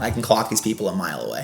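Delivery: ordinary talk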